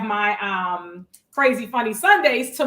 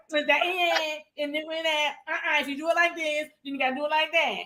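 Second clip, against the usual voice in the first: higher-pitched mock accent; exaggerated accent imitation, pitched up; Alicia; 5 semitones higher than usual. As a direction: keep teasing with the friend's accent. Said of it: "is that my accent".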